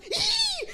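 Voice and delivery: very high panicked gasp; sharp gasp. Retold or imitated. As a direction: gasp in terror. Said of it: "elle fait une rotation".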